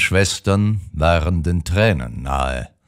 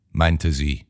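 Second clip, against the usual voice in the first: plain reading voice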